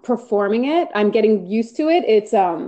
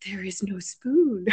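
put-on creepy kid voice; 7 dB quieter, slower than usual. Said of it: "little boy that's like".

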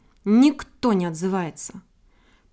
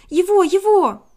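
high, loud quick chirp; chirping bird; 8 semitones higher, 8 dB louder than usual. A narrator's reading. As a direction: chirp it eagerly. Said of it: "защебетала Синичка".

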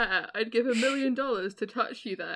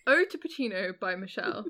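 ordinary delivery

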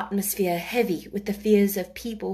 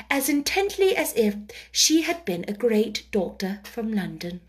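ordinary delivery, nothing marked

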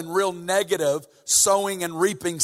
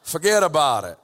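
put-on New York accent; 2 semitones lower than usual. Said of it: "Jesus with a New York accent coming in".

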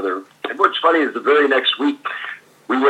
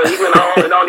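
ordinary delivery

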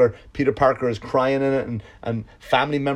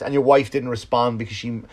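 ordinary talk

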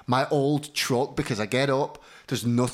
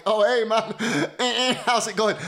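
higher, laughing greeting; friendly laugh; 6 semitones higher than usual. Retold or imitated. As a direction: greet him warmly through a laugh. Friendly reply to the fan.

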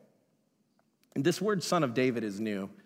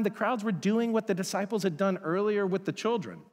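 usual speaking voice

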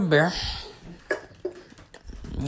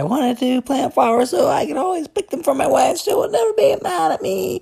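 high mock-sweet voice; 12 semitones higher, 4 dB louder than usual.

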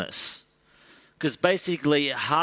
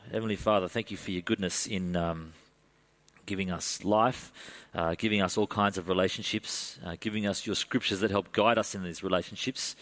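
prayer; 3 semitones lower, 4 dB quieter than usual. Acted. Pastor prays before sermon.

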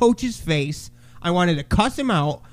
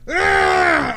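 high snarling "ah", imitating a kid's mean face; play-acted battle cry; mock fierce yell; 12 semitones higher than usual. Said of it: "Show me a mean face".

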